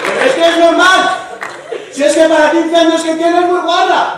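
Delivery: high, loud cheeky yell; mock shout. Answering the friend at the disco, high, loud mock yell. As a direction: shout the jab over the music.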